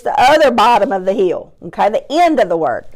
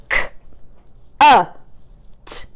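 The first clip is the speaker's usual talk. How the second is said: chopped-out word. Shown as a demonstration. Teacher sounding out a word for pupils, chopped apart. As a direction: CUT split into three separate sounds, long gaps. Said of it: "I'm gonna chop out this word".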